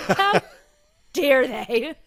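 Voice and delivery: hammed-up indignation that breaks into laughter; laughing mock outrage. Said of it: "It was so real".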